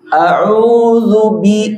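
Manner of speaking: A'udzu bi drawn out slowly with clear dz; correct recitation. Shown as a demonstration. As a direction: chant A'udzu bi slowly, lips rounded on u. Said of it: "gitu ya".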